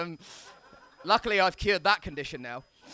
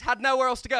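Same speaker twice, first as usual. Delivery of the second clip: usual speaking voice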